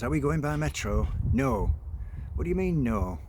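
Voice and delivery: put-on loud, high child voice; yelling child. Niece yelling at her uncle, high and loud. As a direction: shout at uncle like a cheeky kid.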